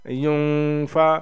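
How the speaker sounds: युनफा with a long nasal hum on न; slow युनफा, humming through the nose; nasal ghunna. Shown as a demonstration. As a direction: hold the न of युनफा in the nose before फा. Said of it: "नाक में आवाज़ को छुपा के".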